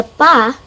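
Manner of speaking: "pa" said alone, loudly and clearly; pa syllable. Shown as a demonstration. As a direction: isolate the syllable "pa" with a firm stop. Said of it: "it's like saying".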